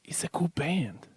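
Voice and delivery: slow whispered aside; whispering. Real, not acted. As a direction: whisper it like a secret. Aside plug.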